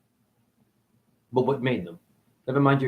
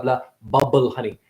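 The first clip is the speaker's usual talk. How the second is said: normal talking voice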